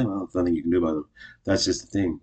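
ordinary delivery

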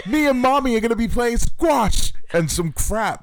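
high, loud shrieking diva; hammed-up posh brat, loud and high; mock drama queen. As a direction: shriek at the heathens. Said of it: "She just says the dumbest shit".

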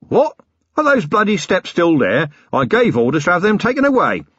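a blustering self-made man playing host; cheeky boss offering coffee, tea, something stronger; Harry King rough boss. Harry King plays innocent host.

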